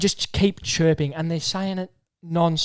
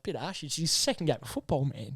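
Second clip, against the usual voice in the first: soft, low-key aside; muted protest; 3 semitones lower, 13 dB quieter than usual. Retold or imitated. Telling how he reacted to the heckler, muted protest. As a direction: grumble it quietly under the noise.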